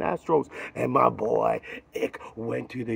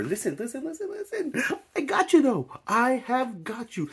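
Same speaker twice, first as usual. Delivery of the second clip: hyped outro; 11 semitones higher than usual. Acted.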